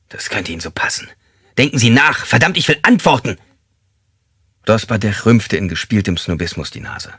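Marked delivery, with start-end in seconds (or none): 1.6-3.4 loud, raised-pitch interrogator; angry demand. Acted. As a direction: demand answers forcefully. Detective demands answers from suspect.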